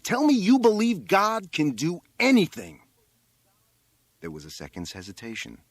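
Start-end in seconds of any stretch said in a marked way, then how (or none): 0.0-2.8 high, loud insistence; shouted demand. A narrator's reading. Boy on the roof interrupting the rabbi, shouted demand.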